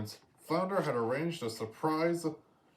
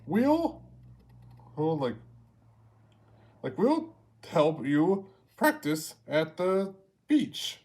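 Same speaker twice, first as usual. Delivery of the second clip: higher, louder, halting seagull voice; bumbling Scuttle; 5 semitones higher, 6 dB louder, slower than usual. A narrator's reading. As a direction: stumble over an eager offer. Scuttle fumbles eagerly.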